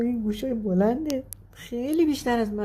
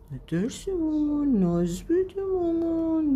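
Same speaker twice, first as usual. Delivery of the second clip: cooing pet talk; 8 semitones higher than usual. Real, not acted. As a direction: coax a frightened little one gently. Coos to scared pet.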